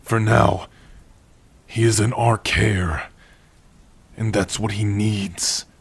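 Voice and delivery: ordinary delivery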